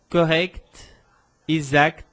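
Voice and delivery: "correct, exact" with both k and t sounded; CT final prononcé. Shown as a demonstration. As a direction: finish "correct" and "exact" on a crisp kt. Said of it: "eu preciso pronunciar esse ct".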